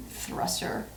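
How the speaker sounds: breathy whispered "Thruster". Real, not acted. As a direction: whisper it under your breath. Whispered echo of joke word.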